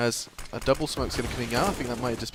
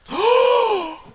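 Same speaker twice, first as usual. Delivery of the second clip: shocked gasp; 6 dB louder than usual. Real, not acted. Shocked play-by-play.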